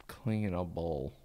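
slow, soft, low "Cleanable"; drawn-out word. Shown as a demonstration. Friend testing a doubtful word, slow drawn-out sounding-out. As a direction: stretch "Cleanable" out syllable by syllable, quietly.

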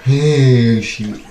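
ordinary talk, nothing marked

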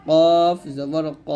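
slow "কফ" with thick, rounded "ক"; heavy letter. Shown as a demonstration. Teacher contrasting letters for learners, "কফ" slow and heavy. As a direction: stretch "কফ", end on heavy "ক". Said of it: "কা বলা যাবে না".

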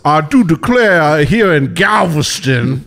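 slow, hammed-up Southern belle; old Southern belle accent. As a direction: declare it in a drawn-out antique Southern accent. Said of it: "like, an old Southern belle".

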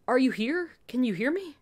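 desperate to get through to him; worried pleading questions; anxious. Acted. Anxious check on waking patient.

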